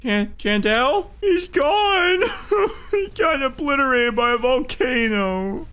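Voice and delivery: high-pitched laughing disbelief. Real, not acted. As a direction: crack up, voice squeaking high. Character destroyed by volcano.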